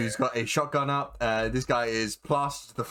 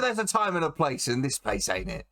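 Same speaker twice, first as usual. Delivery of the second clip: lightly voiced, higher-pitched onlooker; opinionated onlooker; 6 semitones higher than usual. Crowd member on a shanty in the wrong place, lightly voiced griping.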